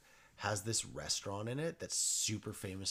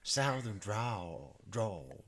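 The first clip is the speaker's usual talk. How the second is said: southern drawl; 3 semitones higher, 13 dB louder, slower than usual. Acted. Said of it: "I can't even understand what you're saying".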